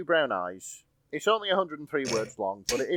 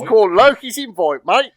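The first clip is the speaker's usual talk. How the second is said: loud, high mock Cockney; bursting into a hammy Cockney; Cockney accent; 8 semitones higher, 13 dB louder than usual. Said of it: "Your inner Cockney tried to break loose".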